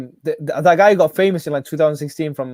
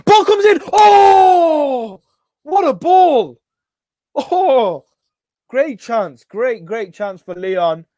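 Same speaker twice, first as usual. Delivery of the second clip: excited; 7 semitones higher, 5 dB louder, much slower than usual. Real, not acted. Live reaction to a chance.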